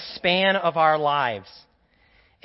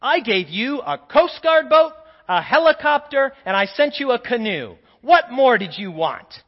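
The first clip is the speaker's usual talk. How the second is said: exasperated God; 5 semitones higher, 7 dB louder than usual. Acted. God answering the drowned man.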